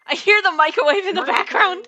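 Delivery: loud and high; loud teasing. Real, not acted. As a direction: call it out loudly. Teasing friend about cooking noise.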